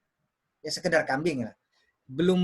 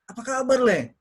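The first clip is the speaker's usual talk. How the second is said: higher, animated hello with KABAR lifted; friendly raised-pitch check-in; lively greeting; 4 semitones higher, 3 dB louder than usual. Shown as a demonstration. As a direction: greet a pal with life in it.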